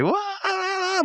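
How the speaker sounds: very high falsetto wah; hammed-up cartoon yelp; high mock yelp. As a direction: squeal like a man flung skyward. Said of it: "Pops goes".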